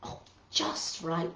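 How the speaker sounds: soft and slow, almost whispered; a quiet satisfied murmur; hushed pleased. Acted. Amelia Bedelia pleased with her pie, slow whisper.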